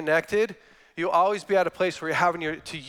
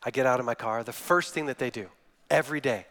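usual speaking voice

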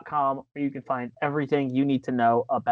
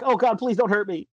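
scared ref; 7 semitones higher than usual. Retold or imitated. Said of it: "other times refs are like".

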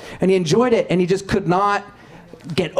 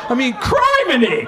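high, laughing near-shout of indignation; hammed-up dad protesting bad language; outraged prudish dad; 7 semitones higher than usual. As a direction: sputter at the TV like a scandalized father. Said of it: "My dad's like".

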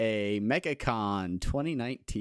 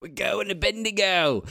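raised, louder mock Australian accent; hammed-up Australian accent for a Bendigo trip; poor Australian accent; 10 semitones higher, 5 dB louder than usual. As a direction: put on a jokey Aussie accent. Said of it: "we do poor accents".

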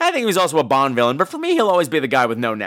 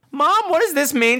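put-on high-pitched child's question; innocent child; 9 semitones higher than usual. Child asks mother about the book, mock innocent. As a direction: imitate a puzzled eleven-year-old. Retold self as child asking.

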